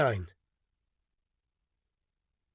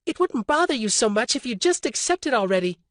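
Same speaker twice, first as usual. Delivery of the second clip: higher woman's voice, teasing; Orly, wry; 7 semitones higher than usual. A narrator's reading. Orly teasing stubborn Alton, wry woman's voice. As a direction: needle him gently.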